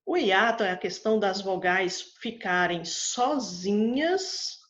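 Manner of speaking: normal talking voice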